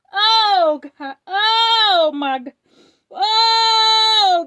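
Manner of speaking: loud, high sobbing wails; crying out to God. Real, not acted. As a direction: cry out in overwhelmed worship, weeping. Overwhelmed reliving the prophecy.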